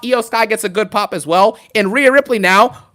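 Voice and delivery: usual speaking voice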